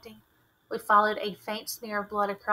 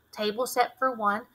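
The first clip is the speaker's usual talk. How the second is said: plain reading voice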